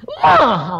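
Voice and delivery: high, loud mock wail; whining. Refused a house by God, mock childish wailing. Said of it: "pokoknya ngerengek terus aku bilang".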